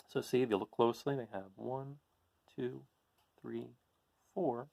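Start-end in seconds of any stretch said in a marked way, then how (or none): none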